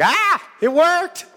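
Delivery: very high, loud squealing witch; hammed-up witch squealing at her own success; little witch lady. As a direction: shriek it in amazed comic falsetto. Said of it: "This little witch lady was like".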